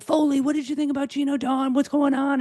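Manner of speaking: voiced high, pushy friends demanding news; lightly hammed-up nosy caller; frantic texting friends. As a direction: mimic people blowing up her phone. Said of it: "It's like".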